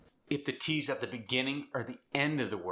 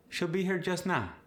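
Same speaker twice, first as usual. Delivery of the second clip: South African accent; 8 semitones higher than usual. Shown as a demonstration. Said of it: "South Africans will often say".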